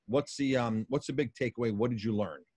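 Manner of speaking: ordinary talk, nothing marked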